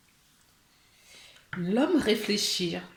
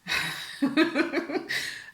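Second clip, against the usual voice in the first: real higher-pitched giggle; giggling; 7 semitones higher than usual. Real, not acted. Card reader amused by the card drawn, giggling. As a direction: break into a real fit of giggles. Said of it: "je rigole parce que".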